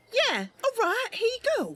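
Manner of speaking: put-on high, eager young woman voice; Mercy paying. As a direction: hand over the coins brightly. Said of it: "counts out the coin".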